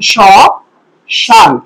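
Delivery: স, শান said as shaan with a nasal vowel, the ম left silent; pronunciation demo. Shown as a demonstration. Teacher showing students how the word is said, nasal vowel, no m. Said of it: "এটার উচ্চারণ হবে এরকম".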